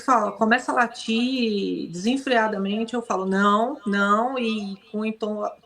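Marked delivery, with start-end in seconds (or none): 3.3-4.4 stern "no" to a dog; suara tegas. Shown as a demonstration. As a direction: say a firm, scolding no, mildly. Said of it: "entoação de voz brava".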